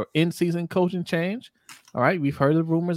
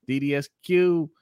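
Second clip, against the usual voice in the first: ordinary talk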